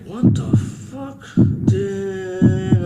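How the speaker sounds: slow, very high, drawn-out shock; acted-out high-pitched amazement; stunned disbelief. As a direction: stretch it into a high incredulous squeal. Reacts to freaky bedroom gear.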